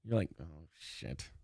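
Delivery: very low, hushed mutter; resigned under-the-breath groan; dreading aside. Retold or imitated. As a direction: mutter it as trouble looms. Inner dread as argument starts.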